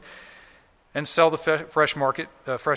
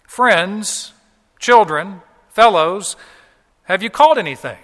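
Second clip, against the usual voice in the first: raised, higher voice hailing strangers; calling out; 4 semitones higher, 9 dB louder than usual. Acted. Stranger on shore hailing fishermen in a boat, calling out. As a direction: call each word out across the water. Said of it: "the stranger calls out to them".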